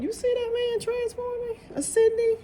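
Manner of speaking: thrilled, pointing out the transforming man; excited high exclaim. Real, not acted. Tarot reader marvelling at the card, high excited exclaim. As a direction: pitch shoots way up in delight.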